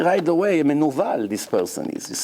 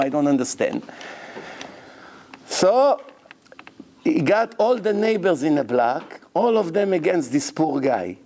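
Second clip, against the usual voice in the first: normal talking voice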